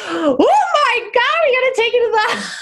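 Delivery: high, gasping imitation of alarmed ladies; hammed-up panic with laughter behind it; mock freaking out. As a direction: draw out a horrified gasp, half laughing. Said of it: "they all started gasping and freaking out".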